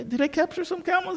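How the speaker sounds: very high, soft questioning; puzzled surprise. Acted. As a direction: play the baffled king asking around. King checks with his men.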